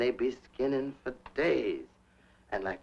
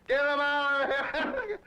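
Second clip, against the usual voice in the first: laughing mock order; 13 semitones higher than usual. Mother ordering, retold laughing.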